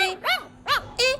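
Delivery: put-on high, whiny small-child voice; protesting toddler. Dog snatches Munna's trolley, whining toddler protest. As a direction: protest in a high babyish whimper.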